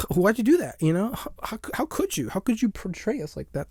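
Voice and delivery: high, pleading mock outrage; upset crowd. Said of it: "now the crowd's all, like, upset".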